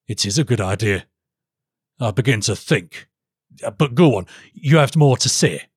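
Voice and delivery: regal, considering the advice; pondering king. Acted. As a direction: weigh it up as a king.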